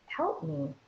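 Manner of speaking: high, soft, small voice begging for help; small plea for help. Retold or imitated. The body pleads with its owner, high and soft. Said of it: "It's saying".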